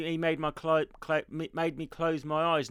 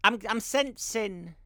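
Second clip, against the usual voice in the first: fortune teller; 3 semitones higher, 5 dB quieter than usual. Pretend. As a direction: draw it out like a stage psychic. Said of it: "you sound like a psychic".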